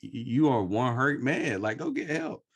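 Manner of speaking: high, loud, exclaiming imitation; mock exasperation. Telling a hurt man to get help, mock exasperated. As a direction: voice leaps up in comic disbelief. Imagined blunt reaction to himself.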